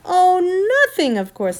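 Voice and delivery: loud sarcastic outburst, high on the first word; mock innocence with a heavy edge. A narrator's reading. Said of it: "said Polly sarcastically".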